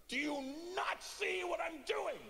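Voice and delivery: high, slow outraged shout; drawn-out indignant yell; angry daughter. Retold or imitated. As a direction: imitate a child scolding like a grown woman. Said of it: "She got angry".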